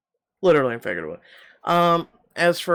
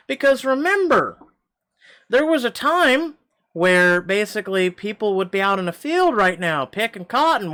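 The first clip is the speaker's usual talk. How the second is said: impassioned; 6 semitones higher, 6 dB louder than usual. Real, not acted.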